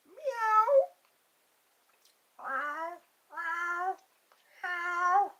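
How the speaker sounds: high, drawn-out mock meow; cat meow. Imitates a cat.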